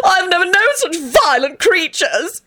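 high, tearful theatrical wail; screamed in fear. A narrator's reading. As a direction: wail slowly in dramatic horror. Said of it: "he screamed".

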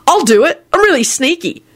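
high, eager volunteering. A narrator's reading. George volunteering to sneak, eager. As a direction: pipe up keenly.

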